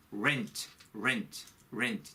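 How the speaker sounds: rent repeated with curled English r; rent, rent, rent, tongue curled. Shown as a demonstration. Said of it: "R 발음이니까".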